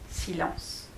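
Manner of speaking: ordinary delivery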